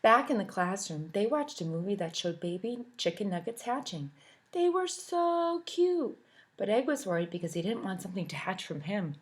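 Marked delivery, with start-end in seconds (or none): 4.5-6.2 high, slow cooing. Acted. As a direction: gush over the babies. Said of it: "cooing over pictures".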